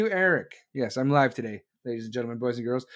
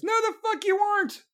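high, sharp disbelieving comeback; incredulous retort; 13 semitones higher than usual. Real, not acted. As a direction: shoot it down, high and scornful.

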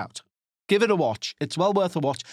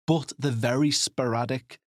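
ordinary talk, nothing marked

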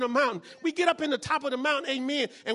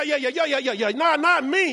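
usual speaking voice

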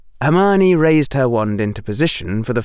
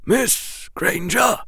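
slow headmaster's call, pitched up; gentle, perhaps weakened; 11 semitones higher, slower than usual. A narrator's reading. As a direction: call her gently, drawn out. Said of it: "His voice might have been gentle".

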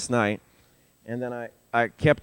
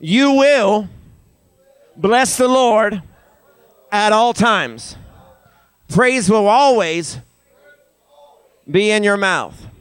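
slow, raised phrases for a crowd to echo; feed it to them phrase by phrase; higher, deliberate call-and-response lead; leading a repeat-after-me; 6 semitones higher, 4 dB louder, slower than usual. Shown as a demonstration. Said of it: "Say it again".

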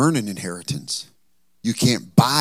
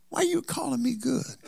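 surprised question; 13 semitones higher, 6 dB quieter than usual. Acted. Jesus questioning the flattery.

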